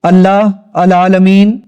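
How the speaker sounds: "اللہ العالمین" slow and loud, second word misread; "اللہ العالمین", drawn out and projected as a faulty test; half-wrong recitation. Shown as a demonstration. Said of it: "ایک word ٹھیک پڑھوں گا".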